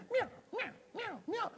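high mewing cat; high little meows; cat impression. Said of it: "And the cat goes".

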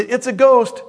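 high, loud cry of fright, acted; mock alarmed cry. Preacher voicing disciples who see Jesus on the water, mock alarmed cry.

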